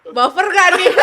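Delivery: usual speaking voice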